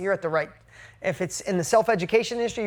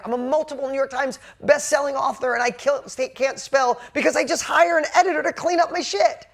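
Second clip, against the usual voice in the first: loud, high-pitched defiant boast; heated, raised voice; worked-up rant; 7 semitones higher, 4 dB louder than usual. Real, not acted. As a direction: fire it off, fed up and proud. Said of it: "I'm just in a mood".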